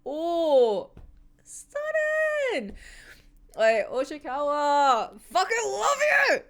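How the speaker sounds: high, swooning rave over the panel; gushing. Real, not acted. Fan swooning over the panel, high gushing rave. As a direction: melt over the artwork, voice soaring.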